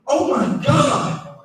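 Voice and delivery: high, long drawn-out awe; mock amazement. Lover seeing his secretary's reveal, drawn-out mock amazement.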